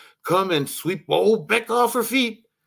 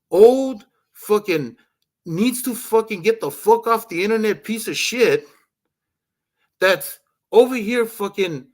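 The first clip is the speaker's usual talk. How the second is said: seething outrage, pitched up; furious rant; 4 semitones higher than usual. Real, not acted. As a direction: spit out the insults.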